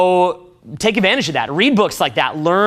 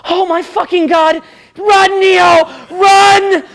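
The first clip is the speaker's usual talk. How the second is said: much higher, much louder frantic shout; Trinity panicking; 11 semitones higher, 6 dB louder, slower than usual. Retold or imitated. Trinity yelling at Neo to run, in a frantic high shout. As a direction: scream it like she's begging him to flee. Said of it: "And Trinity's like".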